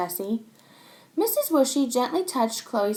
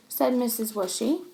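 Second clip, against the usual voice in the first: plain reading voice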